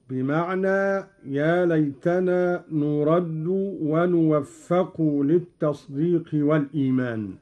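ordinary talk, nothing marked

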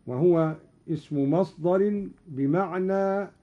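normal talking voice

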